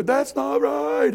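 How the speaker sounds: high, drawn-out complaint; mock upset protest. Poked listener mad at the pastor, high mock protest. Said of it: "you're gonna be mad at me".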